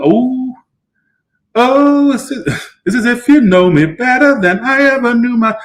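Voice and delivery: high, gasping wonder; sudden high-pitched awe; overcome. Real, not acted. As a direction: swept away by the words. Moved, high voiced reaction.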